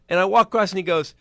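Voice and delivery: usual speaking voice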